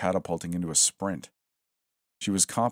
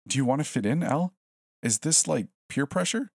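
higher, mocking disbelief; incredulous; 9 semitones higher than usual. Smith mocking Elle for fitting in, high and incredulous. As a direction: challenge her, half laughing at it. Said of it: "She didn't understand".

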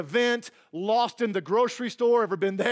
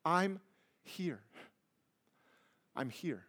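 soft, fading hush of reassurance; calm a crying child, getting quieter and quieter; soothing a panicked child; 4 dB quieter than usual. Retold or imitated. Parent to a panicked child, soothing and fading to a hush. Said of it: "Let him say".

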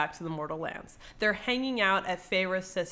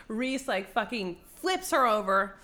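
normal talking voice

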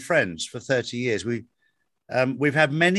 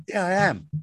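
higher-pitched, mildly proud retold reply; 5 semitones higher than usual. Friend admitting he is spiritual, proud. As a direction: imitate a friend's proud admission. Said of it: "he said it with pride".

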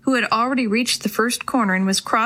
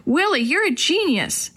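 higher-pitched excited praise; almost shouting; 6 semitones higher than usual. A narrator's reading. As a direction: exclaim it in delight, short of a shout. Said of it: "Maria almost shouted".

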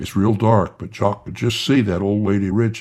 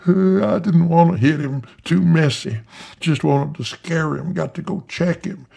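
put-on old man hollering; 8 semitones higher than usual. Old man Rich hollering to his wife after firing. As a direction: holler it out, pleased with the shot. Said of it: "Old man Rich is hollering".

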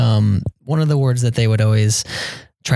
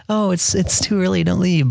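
much higher, sing-song hospitable protest; polite host protest; 9 semitones higher than usual. Retold or imitated. Brazilian host seeing guests out, high sing-song. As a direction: play the gracious host who won't let you go.

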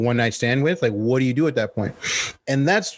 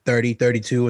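ordinary delivery

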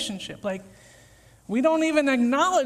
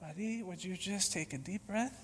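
much softer, low, calm reply; gentle reassurance; 5 semitones lower, 14 dB quieter than usual. Retold or imitated. Jesus gently answering the panicking believer, soft and calm. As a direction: soothe him like a patient friend.

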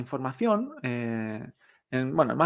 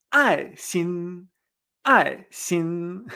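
"Ai" dropping sharply, "sin" held level; falling then level tones; much slower than usual. Shown as a demonstration. Teacher drilling love that kills, "Ai" falling and "sin" level. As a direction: strike "Ai", then sustain "sin" evenly. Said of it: "el cuarto tono".

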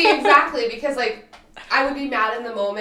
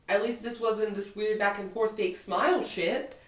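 usual speaking voice

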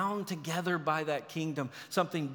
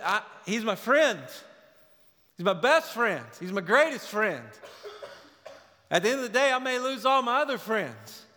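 louder, higher, driving preacher's cry; impassioned preaching; 4 semitones higher, 3 dB louder than usual. Acted. Preacher to church, rising impassioned sermon voice. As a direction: preach it out to the congregation.

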